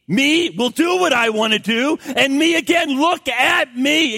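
put-on high, loud childish me voice; a demanding little child, slow on look at me; self-centred toddler. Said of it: "He demonstrates me".

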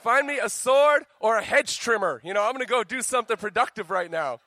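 high, loud, roaring battle call; hammed-up heroic shout; mock warrior cry. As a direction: bellow it like a gladiator going to mow the lawn. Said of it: "You know what I'm talking about".

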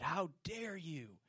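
shrill, loud accusing challenge building in anger; angry Pharisees. Acted. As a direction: confront him, building to outrage. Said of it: "three dark, gloomy figures ... they said".

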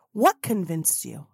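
ordinary talk

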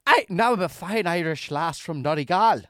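loud, high imitation Irish brogue; mock Irish accent. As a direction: burst into a hammed-up Irish accent. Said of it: "I need to hear her Irish accent".

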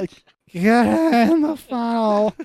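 high, slow mock squeal; mock drunk girl voice. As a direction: pitch it up, drawn out. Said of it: "does not sound like a Burmese accent".

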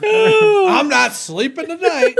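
laughing shout. Real, not acted. Joking fear of mantis visit.

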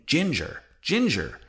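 each word set apart with a falling tune; slow, deliberate repetition. A narrator's reading. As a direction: weigh the word slowly, mildly incredulous. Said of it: "slowly saying".

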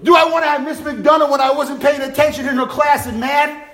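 ordinary delivery

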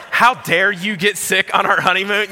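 high, loud mock scolding; frustrated wife. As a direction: shout it as an outraged imitation of a wife. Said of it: "I'm so frustrated with you".

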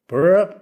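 quick rolled flutter sound; imitated flute flourish. Shown as a demonstration. Flutist showing what a flourish is, imitated trill. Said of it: "how you do a flourish on the flute".

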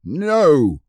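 loud high defiant no; a boy's defiant shout; bawled. A narrator's reading. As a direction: bawl it at the enemy. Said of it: "bawled Robert".

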